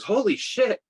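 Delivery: high-pitched imitation of an astonished kid; amazed kid. Kid spotting a Ghostbuster on a scooter, high amazed outburst. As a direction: blurt it out like a kid stunned by what he sees. Kid spots the costumed rider.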